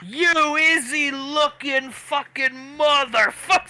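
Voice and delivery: high, loud raging curse; furious yell. Acted. Fighter yelling curses while charging at Julius. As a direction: spit it out charging in fury.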